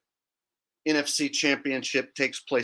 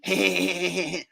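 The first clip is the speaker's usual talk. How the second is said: short laugh; 4 semitones higher than usual. Real, not acted. Laughing at own impression.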